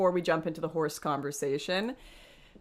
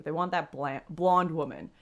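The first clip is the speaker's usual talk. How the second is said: ordinary talk, nothing marked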